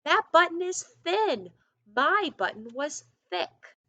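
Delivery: high, loud storybook shout. Acted. Toad shouting at Frog by the muddy river. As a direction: shout it out, fed up. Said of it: "shouted Toad".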